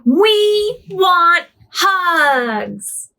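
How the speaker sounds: drawn-out high shout, very slow; excited shout. Acted. Animals clamouring at Bernard, drawn-out excited shout. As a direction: call out like a crowd of eager animals. Animals crowd calling for hugs.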